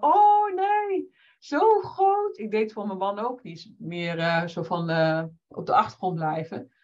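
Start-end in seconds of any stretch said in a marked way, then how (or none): 0.0-2.4 high, startled oh no; shocked gasp. Retold or imitated. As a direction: squeal it in dismay. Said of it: "En ik van".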